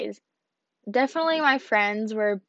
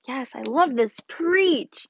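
retold cheer, pitch leaping up on the last word; eager amen-style approval, acted out; enthusiastic cheering; 3 semitones higher, 3 dB louder, slower than usual. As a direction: cheer the speaker on, as if retelling it. Acting out her own enthusiastic cheering.

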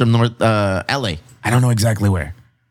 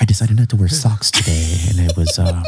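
sultry narrator; 3 semitones lower than usual. Retold or imitated. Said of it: "That sultry voice".

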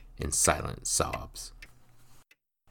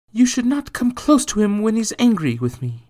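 worried mother voice; 10 dB louder than usual. Acted. Margot warns her son.

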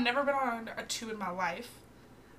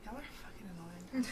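low, soft annoyed grumble; annoyed mutter; 4 semitones lower, 10 dB quieter, faster than usual. Retold or imitated. Friend retelling her annoyed mutter in the tube, soft. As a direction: grumble it under your breath. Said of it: "I'm like this".

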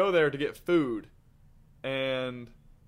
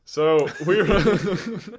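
laughing; 7 semitones higher, 6 dB louder, much slower than usual. Real, not acted. Laughing while starting story.

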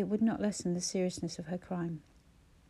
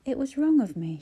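ordinary delivery, nothing marked

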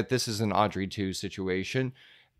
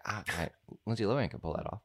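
quick aside; 8 dB quieter, much faster than usual. Retold or imitated. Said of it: "I was like".

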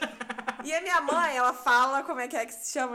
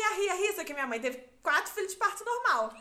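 normal talking voice

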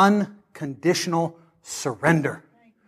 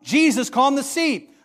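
ordinary talk

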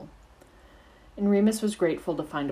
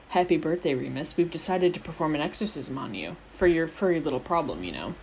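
ordinary delivery, nothing marked

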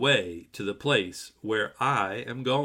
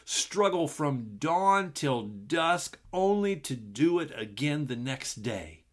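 normal talking voice

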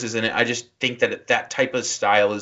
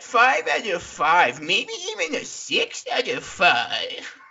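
much higher, mocking voice, laughing through it; impression of Jake; 9 semitones higher than usual. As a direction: squeak out a gushing arthouse review. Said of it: "He totally nailed me".

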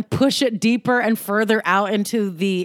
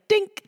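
high mock ding; 10 semitones higher than usual. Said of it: "I'm just like".

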